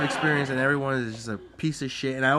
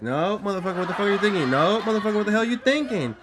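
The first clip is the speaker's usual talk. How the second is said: higher, rising mock outrage; heated retold protest, pitched up; acted indignant refusal; 7 semitones higher, faster than usual. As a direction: refuse him with mounting indignation. Acting out refusing to let driver in.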